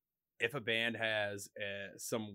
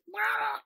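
cat yowl imitation; 5 dB louder than usual. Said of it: "she just goes".

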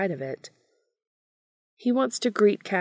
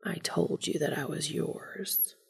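slow, soft, intimate murmur; whispered; 7 dB quieter than usual. A narrator's reading. Castiel at Dean's ear, whispering. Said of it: "Castiel whispers".

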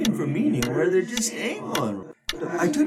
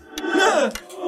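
a big amused laugh, pitched high; hearty laugh. Acted. Ghost laughing at the old-man joke, hearty laugh.

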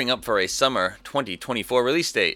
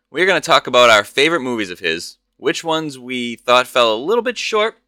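ordinary talk

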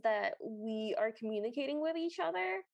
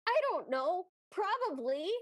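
higher helpless outburst; exasperated Anya; 6 semitones higher than usual. Acted. Anya throws her hands up at the troll, exasperated. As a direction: toss it off higher, giving up. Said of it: "throws her hands up and goes".

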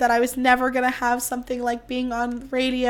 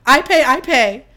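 emphatic; 7 dB louder than usual. Real, not acted. Insists she pays for herself.

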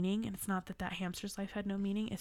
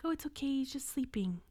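higher, naive little reassurance; innocent child self; 6 semitones higher than usual. Retold or imitated. Girl who had no idea what death was, innocently reassuring. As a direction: imitate your younger self, pitched up and unworried.

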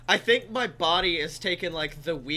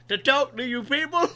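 Cosby voice; 6 semitones higher, 5 dB louder than usual. Pretend. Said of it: "One side says Cosby".

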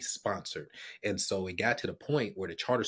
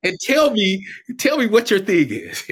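much higher and louder, fired up; excited quote; 13 semitones higher, 14 dB louder than usual. Retold or imitated. Student excitedly challenging Doctor Arnold, loud and high. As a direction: belt it like an eager young man pitching.

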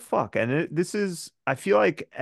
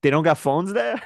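mocking disbelief; 6 semitones higher, 6 dB louder than usual. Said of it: "I was just like".

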